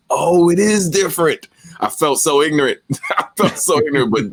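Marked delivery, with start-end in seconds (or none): 0.1-1.5 higher-pitched dawning realisation; acted surprise. Hearing the friend's King's English, mock astonishment. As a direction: replay the astonished discovery, pitched up.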